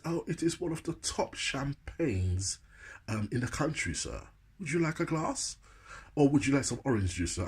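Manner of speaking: polite posh serving voice; posh butler. Retold or imitated. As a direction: present it like a very proper butler. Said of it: "he talked quite posh".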